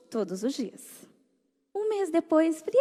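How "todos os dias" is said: softer and slow, lingering; soft echo. Real, not acted. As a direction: let it sink in quietly. Soft repeat. (eco mais suave e lento)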